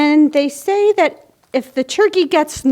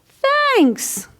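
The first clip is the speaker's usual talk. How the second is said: chorus of thanks; 13 semitones higher than usual. Acted. All the friends say thanks.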